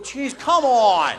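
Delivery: loud, slow, high protest; put-on loud groan of complaint; exasperated. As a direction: drag it out in mock exasperation. Acts out exasperated reaction.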